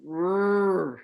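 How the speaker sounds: Rrr with a growled rolled R; R sound. Shown as a demonstration. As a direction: hold the R, lips rounded. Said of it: "you can't smile and do it".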